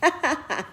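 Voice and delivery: high burst of laughter; real laugh at a silly question; amused laugh. Real, not acted. Laughs at question about cameras.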